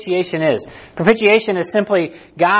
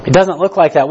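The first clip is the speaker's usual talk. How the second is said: louder, hammering the point home; emphatic repeat; 8 dB louder than usual. Real, not acted. Pastor to congregation, louder emphatic repeat. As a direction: drive it home to the room.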